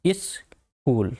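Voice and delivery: स्कूल को धीरे खींचकर इस्कूल बोलें, शुरू की इ साफ़ सुनाई दे; स्कूल धीमे से, इ पर ज़ोर देकर; slow iskool. Shown as a demonstration. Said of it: "ई की साउंड आ रही है". (धीमा और खिंचा हुआ इस्कूल)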